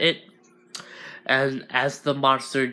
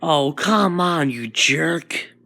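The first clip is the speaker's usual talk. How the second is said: slow, louder mock grumble; exasperated; 3 semitones higher, 3 dB louder, slower than usual. Izuku griping at the monster, exasperated. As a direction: drag out the complaint, fed up.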